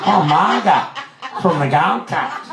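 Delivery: slow, high, hammed-up Aussie drawl; Margot, broad Australian accent. Introducing herself to a famous director, broad Australian. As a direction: stretch out the Australian vowels, high. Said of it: "wrong accent".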